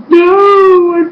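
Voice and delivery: high, drawn-out wail of protest; hammed-up fan despair; mock dismayed cry. Replays dismayed reaction to show.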